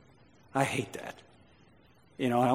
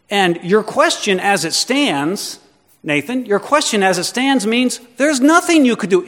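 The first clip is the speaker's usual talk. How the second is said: loud, raised-pitch preaching; forceful rebuttal; 4 semitones higher, 6 dB louder than usual. Acted. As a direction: press the challenge home with mounting insistence, then ease off. Preacher voices Jesus' forceful rebuttal.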